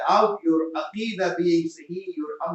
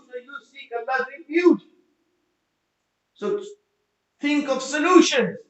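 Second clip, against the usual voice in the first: high, loud, fervent sermon voice; impassioned call to seek refuge; full-force preaching; 9 semitones higher, 7 dB louder than usual. Acted. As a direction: preach it out, rousing the crowd.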